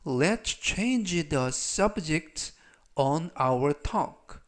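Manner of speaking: very slow, each word stretched; slow model sentence. Shown as a demonstration. Teacher slowly reads the sentence about changing the subject. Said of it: "전체적 의미는".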